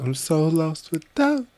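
high, whiny mock-lovesick voice. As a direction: play it heartbroken and high. Playful dramatic line.

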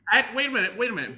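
heated sports argument, cutting in; indignant protest. Real, not acted. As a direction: jump in with outraged disbelief. Indignant protest, much higher, louder.

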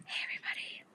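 soft breathy whisper into the mic; ASMR whisper. Shown as a demonstration. Hosts mock an ASMR episode, whispering. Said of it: "We should do an A S M R episode".

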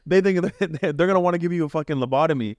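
high, fast words breaking into laughter; laughing disbelief. Real, not acted. To his co-host about the elders' views, laughing in disbelief. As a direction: laugh through it, half mocking the idea. Mocking relatives' views on therapy.

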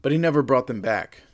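"never" said loud and firm; emphatic never. A narrator's reading. Dramatic repeat.

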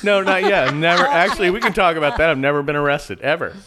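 loud laughing protest; emphatic. Real, not acted. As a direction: insist it loudly, amused. Protesting jail joke.

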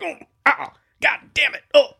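high, loud yelps of mock pain; sudden high ouches and a curse; acted pained yelps. Sneaking invaders poked in the feet by thistles, mock pained yelps.

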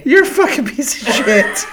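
high, loud, incredulous jibe; amused mock condemnation; laughing insult. Real, not acted. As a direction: roast your friend through laughter. Jokingly condemns friend's confession.

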